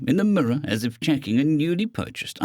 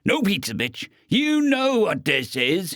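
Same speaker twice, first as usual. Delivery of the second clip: higher, slow threatening robot voice; slow, menacing, gun in hand; menacing robot gunman; 9 semitones higher, slower than usual. Acted.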